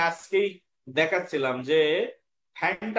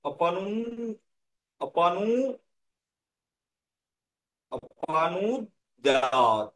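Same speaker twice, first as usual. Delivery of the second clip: drawn-out Sanskrit syllables; slow, measured recitation for pupils; slow recitation model; much slower than usual. Shown as a demonstration. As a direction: model the verse slowly, stretching each long vowel. Teacher models verse word by word.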